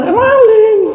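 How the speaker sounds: sudden very high yell of alarm; startled cry. Retold or imitated. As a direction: shout it the moment you see him. Santri spots the thief. (teriakan kaget bernada tinggi)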